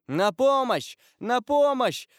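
high, loud cries of alarm; woman's high-pitched panicked cry; screaming for help. A narrator's reading. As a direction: call desperately for rescue. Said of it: "закричала мадемуазель де Армилье".

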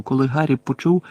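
ordinary delivery

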